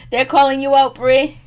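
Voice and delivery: loud, high, laughing jab at Bree; laughing and loudly teasing the cast member; laughing call-out. Real, not acted. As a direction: call her out through a laugh. Calling out Bree while laughing.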